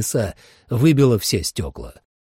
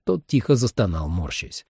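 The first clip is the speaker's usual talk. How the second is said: usual speaking voice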